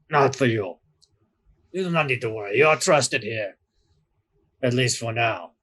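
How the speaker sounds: put-on lightly held Severosi accent, reassuring; a hint of Severosi accent with a veiled warning. Doorman reassuring the crew in a light Severosi accent. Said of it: "thick Severosi accent".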